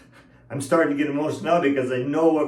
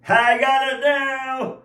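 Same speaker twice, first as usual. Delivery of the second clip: mock triumph; 11 semitones higher, slower than usual. Mock triumph after laughing.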